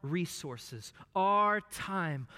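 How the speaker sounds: rising preacher's crescendo; preaching. Acted. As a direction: build it to a preaching climax. Preaching climax.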